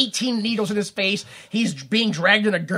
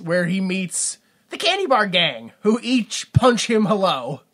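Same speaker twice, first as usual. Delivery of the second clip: ordinary delivery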